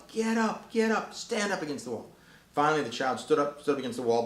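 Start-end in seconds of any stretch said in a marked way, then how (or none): 0.1-2.1 sharp high command; yelled. Retold or imitated. Father yelling at his tantruming child, high sharp command. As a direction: bark it at the child. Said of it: "So he yelled".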